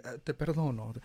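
soft, grudging te perdono, trailing off; dismissive forgiveness. Shown as a demonstration. Ah, te perdono said grudgingly to the person who hurt you. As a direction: brush it off with a sigh. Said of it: "no lo digo en un rollo así como que".

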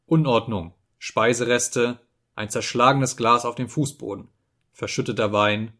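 plain reading voice

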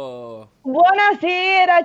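drawn-out cheerful greeting; enthusiastic. Real, not acted. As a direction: greet them with big enthusiasm. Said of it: "quanto entusiasmo".

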